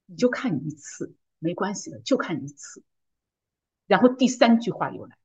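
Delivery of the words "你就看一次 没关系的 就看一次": soft, coaxing inner tempter; lowered, hushed voice of temptation; tempting voice. Retold or imitated. Temptation talks her into one look, softly coaxing. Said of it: "然后第二句话来".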